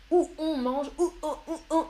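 "Où on" repeated haltingly, the two vowels colliding; awkward vowel hiatus. Shown as a demonstration. Teacher shows students why "où on" is harder, awkward vowel hiatus. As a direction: show how hard "où on" is without a linking L. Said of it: "c'est plus difficile que".

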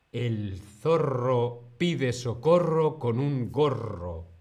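slow, deliberate tongue twister; slow model reading. Shown as a demonstration. Teacher repeats the tongue twister for learners, slow and deliberate. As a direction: stretch the words out for learners.

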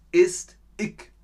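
ich mit k gesprochen, bairisch: ik; dialect ich. Shown as a demonstration. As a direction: say ich as ik, southern style. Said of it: "Im Süden von Deutschland sagt man ich". (ich wie ik, süddeutsch)

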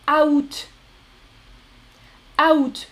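août in two syllables, "a-oot". Shown as a demonstration. Teacher shows regional variant, août as "a-oot". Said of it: "vous pouvez aussi entendre".

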